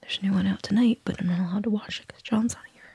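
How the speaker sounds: hushed unvoiced whisper. Real, not acted. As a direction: whisper as if sharing a secret. Confiding like a secret.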